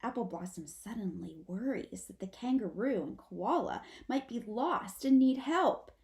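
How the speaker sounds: ordinary delivery, nothing marked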